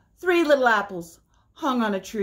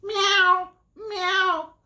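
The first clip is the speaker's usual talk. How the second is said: very high mock meow; cat meow; 9 semitones higher than usual. Cat calling to the spider, very high meow. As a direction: meow like a little kitten. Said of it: "cried the cat".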